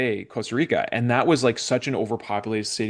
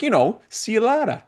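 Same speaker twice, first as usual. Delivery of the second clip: high, loud imitation of a Spanish accent; Spanish-accented friend quoted, higher and louder; 11 semitones higher, 4 dB louder than usual. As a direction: pitch it way up, mimicking Alex's Spanish accent. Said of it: "in his Spanish accent".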